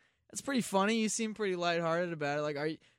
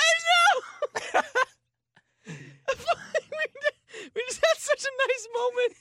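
laughing; 3 dB louder, much slower than usual. Real, not acted. Laughing hard.

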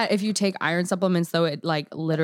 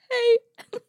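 mocking his squeaky sick voice; high squeaky voice. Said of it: "It kind of goes higher".

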